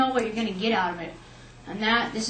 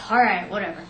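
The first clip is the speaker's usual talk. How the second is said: grudging resignation; 4 semitones lower, faster than usual. Retold or imitated. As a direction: give in with a reluctant sigh. Grudgingly gives in to mom.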